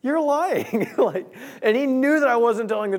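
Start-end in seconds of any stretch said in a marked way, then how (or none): 0.0-0.9 mock accusing. Friend calls out the lie.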